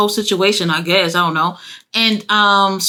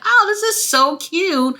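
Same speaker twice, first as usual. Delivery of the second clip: gushing; 9 semitones higher than usual. Retold or imitated. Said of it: "And I'm just like".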